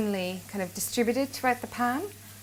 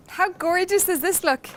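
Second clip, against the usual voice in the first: high, bright admiring exclamation; excited; 10 semitones higher, 6 dB louder than usual. Real, not acted. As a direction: gush over the dish. Cook admiring the finished dish.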